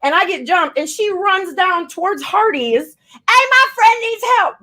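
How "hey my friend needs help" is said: high, louder call. Retold or imitated. Rapper mimicking her friend running off from the fight, calling out. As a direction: imitate a frantic shout for help.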